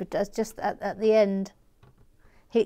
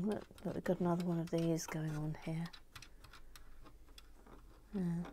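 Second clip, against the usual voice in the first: quiet aside; 4 semitones lower, 18 dB quieter, faster than usual. Real, not acted.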